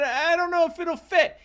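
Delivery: put-on very high-pitched little-kid voice; exaggerated high, unsure kid; doubtful child, acted. Acts child's doubtful reply.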